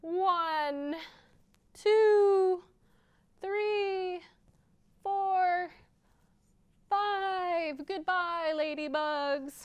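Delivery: high, slow excited countdown; counting launch. Acted. Librarian counts ladybugs flying off, excited. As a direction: count them up into the air. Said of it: "Here we go".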